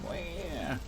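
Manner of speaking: mock baby wail; soft, put-on "wah" of a crybaby; Desmond. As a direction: imitate a crying baby, softly. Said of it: "Do an impression of Desmond?".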